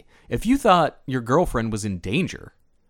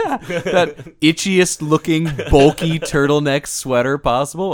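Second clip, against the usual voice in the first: laughing; 4 semitones higher, 7 dB louder than usual. Real, not acted. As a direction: laugh through it. Joking about sweater.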